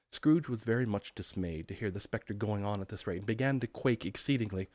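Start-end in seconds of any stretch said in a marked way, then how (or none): none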